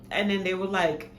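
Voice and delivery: normal talking voice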